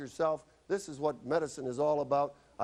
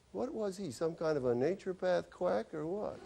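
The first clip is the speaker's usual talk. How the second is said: mocking patient; 6 semitones higher, 4 dB quieter than usual. Mocking patients leaving the office.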